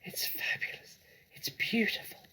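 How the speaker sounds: soft whisper fighting for breath; breathless whisper. A narrator's reading. Fox whispers after a huge gulp of cider. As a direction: whisper it, throat burning. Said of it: "he whispered, fighting for breath".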